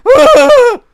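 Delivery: very high, loud shriek; a short cartoon panic squeal; hammed-up footballer screeching in fright; mock Messi scream. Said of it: "Messi's like".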